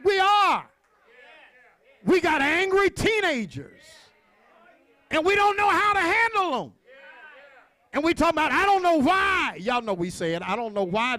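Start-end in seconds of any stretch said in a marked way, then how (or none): 0.0-8.5 high, loud sermon cry; impassioned preacher at full voice; full-force preaching. Real, not acted. 8.5-9.6 high, loud, drawn-out wail of bafflement; preached-up exasperation; exasperated preacher's cry. Acted. Preacher voices exasperated parents, worked up.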